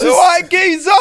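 high, loud cheeky geezer greeting; mock geezer shout. A friend shouting the boyfriend's line for laughs. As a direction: bellow it like a cocky geezer.

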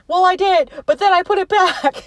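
imitated high-pitched excuse; mock excuse. Retold quarrel, sheepish excuse, teller amused.

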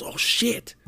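high, breath-heavy alarmed hush; mock alarm. Friend spotting the girl's car, mock alarm. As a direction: react as if caught out, under your breath. Friend spots girl, panics and hides.

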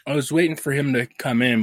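ordinary talk, nothing marked

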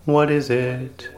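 ordinary delivery

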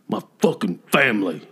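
mock mumbled cursing; mumbling angry man. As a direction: imitate an upset man's slurred grumble. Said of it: "He just mumbled something".

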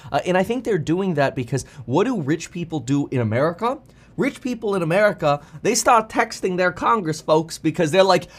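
ordinary talk, nothing marked

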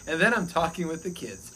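ordinary delivery

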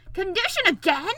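high, outraged royal roar; very loud, indignant shout. A narrator's reading. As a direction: roar in furious disbelief. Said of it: "roared the king".

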